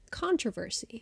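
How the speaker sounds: controversy with stress on CON; soft CON-tro-ver-sy, American style; American pronunciation. Shown as a demonstration. Repeating the American example for listeners, American controversy. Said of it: "An American might say".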